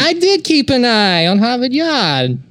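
put-on high, tough-guy Boston accent; Boston Joe Pesci. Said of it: "I'm gonna be a Boston Joe Pesci".

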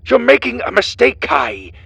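high, loud prisoner's yell; desperate shout. A narrator's reading. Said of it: "shouted at the recording device".

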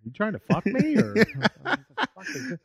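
mock weirded-out reply. Guy hugged by friend, laughing mock suspicion. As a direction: ham it up through laughter. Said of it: "I'm like".

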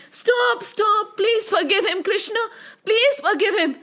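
high-pitched imploring, no real shout; pleading shouts. Acted. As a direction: beg as the frightened wife and children. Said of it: "His wife and children started shouting".

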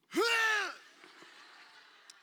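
high, loud burst of laughter; loud spluttering laugh. Retold or imitated. Dad retelling the baby spitting up, loud laugh.

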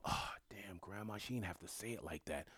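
soft breathy aside, almost under the breath; hushed whisper. Retold or imitated. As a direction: whisper it, stunned after a blunt scolding. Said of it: "and you go".

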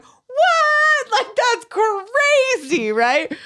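squeaky high, drawn-out mock shock; stunned disbelief. Telling a friend the story, hammed-up high-pitched shock. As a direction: act floored by what you heard, slowly. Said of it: "And I'm like".

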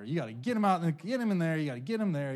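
normal talking voice

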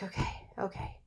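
breathy whispered okay to herself; hushed, calming herself down; self-calming whisper. Retold or imitated. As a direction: soothe yourself under your breath. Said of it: "I calmed myself down".

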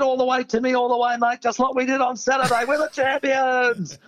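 pitched high, gung-ho chant, last words slowed; a cocky mate urging everyone on; excited mate. Retold or imitated. Retold mate cheering on ladder.

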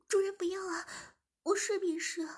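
high, breathy-free pleading plea; pleading submissive voice. Acted. Zhimin begs the shop owner and master to stop, high pleading voice.

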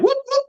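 very high-pitched mock whoops; playful whooping. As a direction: whoop like someone showing off moves. Mimics skateboarder showing off.

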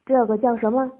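loud, eager questioning; excited question. A narrator's reading. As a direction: ask it eagerly, loudly.

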